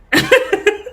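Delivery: short, high giggle; real high-pitched laugh; amused laugh. Real, not acted. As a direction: crack up briefly at your own joke. Laughs at own impression.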